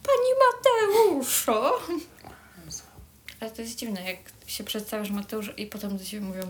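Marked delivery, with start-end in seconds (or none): 0.0-1.7 high, slow sing-song teasing; drawn-out mock-polite "Mrs"; mock lady address.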